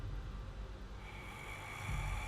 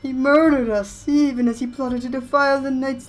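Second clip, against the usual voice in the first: high, loud, slow spectral wail; ghost voice; 6 semitones higher, 9 dB louder, slower than usual. Acted. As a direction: moan it like a wronged ghost. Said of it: "Okay, ghost voice".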